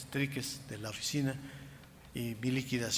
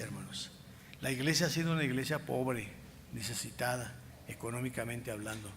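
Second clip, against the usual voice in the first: ordinary delivery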